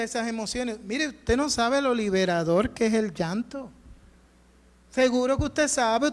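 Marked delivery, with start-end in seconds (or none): none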